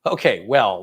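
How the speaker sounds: usual speaking voice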